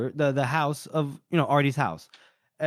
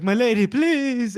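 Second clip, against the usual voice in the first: high, loud begging; pleading; 9 semitones higher, 5 dB louder than usual. Acted. He begs the lady on his knees, pleading. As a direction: plead with the pitch way up, hammed-up.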